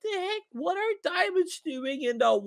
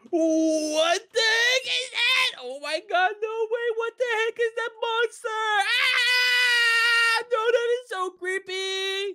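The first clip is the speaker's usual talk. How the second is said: scared screaming; 8 semitones higher than usual. Real, not acted. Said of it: "look at him screaming".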